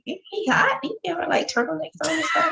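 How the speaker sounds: high-pitched mock questioning; mock incredulous. Co-host reacting to a man in big suits, high mock incredulity. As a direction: ask it high, in mock disbelief.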